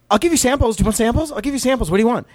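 high, eager imitation of a friendly server; high-pitched, over-friendly mimicry; friendly counter girl. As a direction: imitate a high schooler chirpily offering samples. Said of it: "like, so friendly".

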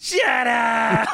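high, hammed-up holler; mock yell at crowd. Imitating Sid yelling at the crowd, high mock yell. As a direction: scream it out at a whole crowd. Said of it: "looks at the crowd and he yells".

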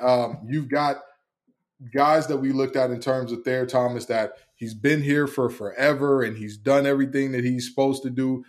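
ordinary delivery

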